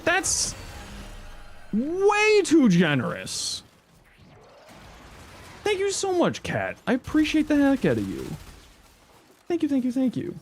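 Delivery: high, gushing thanks; overjoyed. Real, not acted. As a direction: gush with gratitude. Overwhelmed thanks for gift.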